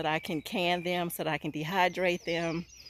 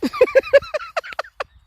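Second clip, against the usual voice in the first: shrieking laugh; 12 dB louder than usual. Real, not acted. Laughs at huge tree.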